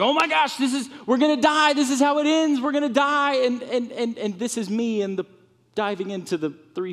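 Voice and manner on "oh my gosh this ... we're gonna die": high, loud panicked cry; freaking out. Acted. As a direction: disciples sure they're about to drown. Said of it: "they're freaking out".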